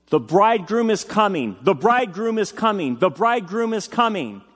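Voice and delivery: high mock yell, repeated. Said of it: "kept yelling".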